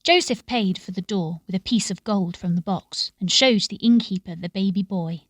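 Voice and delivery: ordinary delivery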